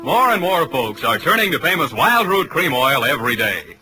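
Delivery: ordinary talk, nothing marked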